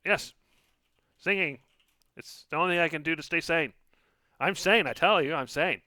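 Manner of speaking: high, loud, fast tiny voice; tiny frantic voice. Acted. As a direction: insist you're sane, frantically. Plays lily spirit, frantic.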